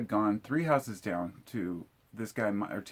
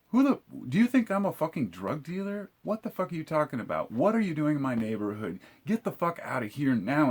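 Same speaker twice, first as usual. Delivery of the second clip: indignant outburst, pitched up then easing down; retold snapping; 6 semitones higher than usual. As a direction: snap at him, retelling the rant without full volume. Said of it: "I just snapped on the guy".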